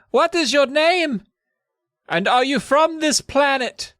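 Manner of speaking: slow, high-pitched mock announcement; exaggerated first-contact voice; officer addressing an alien. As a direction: call up to a giant, slowly and deliberately. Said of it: "the one who will talk to the giant".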